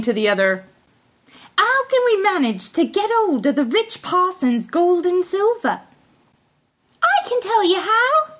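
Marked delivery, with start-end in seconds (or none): 1.6-5.8 thief. A narrator's reading. Thief voiced. 7.0-8.3 high little voice calling out; tiny Tom Thumb calling. A narrator's reading. Tom Thumb calls out to the thieves, high and eager. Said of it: "cried Tom Thumb".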